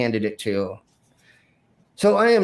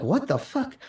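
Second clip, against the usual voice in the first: high-pitched, softer retold disbelief; incredulous shock; 11 semitones higher, 4 dB quieter than usual. Performer spotting the thrown shot glass, incredulous. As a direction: act out baffled shock, pitched right up.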